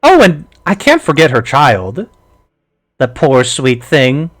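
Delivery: a hint of a mocking crone; lightly voiced witch, pitying sweetly; old hag voice. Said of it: "is the hag says".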